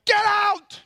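high, full-force acted yell; shouted order. Jesus coming into your home and clearing it, acted shout. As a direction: bellow at them to clear out.

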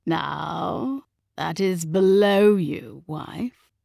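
cool, restrained reproof; coldly cutting. A narrator's reading. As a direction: rebuke her without raising the voice. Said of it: "his tone coldly bored and cutting".

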